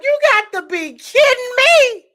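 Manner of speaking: high, loud disbelief; incredulous. Retold or imitated. Retelling her reaction to her nephew, loud and incredulous. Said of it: "I was like".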